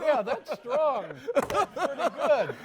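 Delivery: slow, high, laughing praise; laughing approval. Real, not acted. Host praises the guest's joke through laughter, laughing. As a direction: still laughing, savor the joke. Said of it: "laughing at guest's joke".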